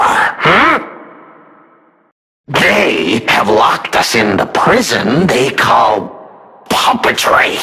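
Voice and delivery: ordinary talk, nothing marked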